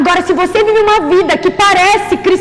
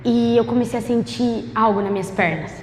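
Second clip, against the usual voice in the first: low, soft, hushed storytelling; testemunho em voz contida; 7 semitones lower, 7 dB quieter than usual. Real, not acted. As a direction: tell it low and quiet, holding back before the climax. Hushed, slow-building testimony storytelling. (voz baixa e contida de testemunho)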